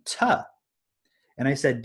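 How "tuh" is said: a high, isolated "tuh." with a t in place of k; the consonant "tuh." clipped short; child's t sound. Retold or imitated. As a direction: imitate the toddler's "tuh.". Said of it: "He's saying".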